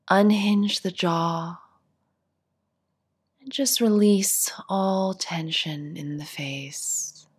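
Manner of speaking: calm, unhurried meditation voice with long pauses; guided meditation. Acted. Teacher guiding seated listeners through a body scan, guided meditation. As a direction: ease them gently through each instruction, leaving space to settle.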